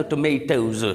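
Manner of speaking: tomatoes the American way, "tuh-MAY-tohz"; American pronunciation. Shown as a demonstration. Teacher gives the plural for students, American "tuh-MAY-tohz". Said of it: "American people इसी word को यूँ".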